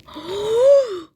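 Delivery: high, loud mock gasp; big drawn-out gasp of pretend shock; exaggerated gasp. Exaggerated gasp about liking the video.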